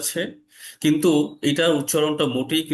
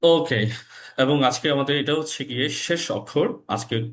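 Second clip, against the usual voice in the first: ordinary delivery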